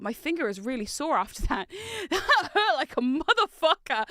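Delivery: loud laugh breaking into the words; pained, giggly complaint; laughing through pain. Real, not acted. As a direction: laugh while nursing a sore finger. Laughs over the sore finger.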